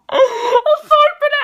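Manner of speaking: high, loud, breaking up with laughter; laughing apology. Real, not acted. Reviewer apologizes for his own impression, laughing. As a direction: apologize through real laughter.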